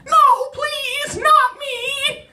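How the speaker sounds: tiny, very high falsetto squeal; desperate squeaky plea; little pleading voice. Acted. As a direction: beg for your life as a tiny shirt button. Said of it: "I hear this little voice going".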